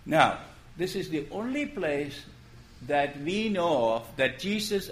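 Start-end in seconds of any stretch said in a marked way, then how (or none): none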